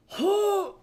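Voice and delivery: high, loud "hoo" of overwhelm; loud, high sound of being swamped; overwhelmed exhale. Real, not acted. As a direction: let out a big high whoop of breath. Overwhelmed by the fight planning.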